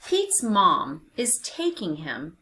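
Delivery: usual speaking voice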